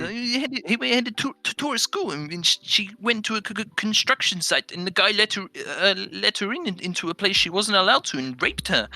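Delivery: high, exaggerated stammering imitation; housemate impression, stuttering. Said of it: "He, he has that tone of voice".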